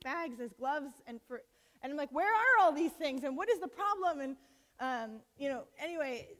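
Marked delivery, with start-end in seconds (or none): 2.1-4.2 exasperated. Retold or imitated. Exasperated quote from the front lines.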